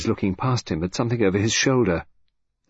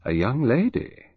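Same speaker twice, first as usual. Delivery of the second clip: wondering; 4 semitones higher than usual. A narrator's reading. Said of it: "Alfred said wonderingly".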